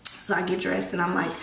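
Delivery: ordinary delivery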